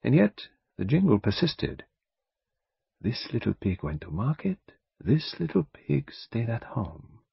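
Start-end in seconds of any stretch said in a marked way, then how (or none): 3.0-7.2 murmured nursery rhyme. A narrator's reading. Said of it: "he murmured".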